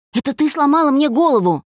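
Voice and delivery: high, heated outburst. A narrator's reading. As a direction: hurl the accusation, pitch jumping up. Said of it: "ещё громче продолжил Олег".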